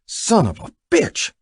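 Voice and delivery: high, slow, loud curse; hissed curse. Acted. Buck curses Jack over the keychain, loud and slow. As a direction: spit the curse out. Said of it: "Buck hissed".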